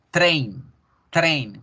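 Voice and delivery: train said as English /tɾen/, ey glide; teacher modelling train. Shown as a demonstration. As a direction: model the word train slowly. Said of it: "como en el tren".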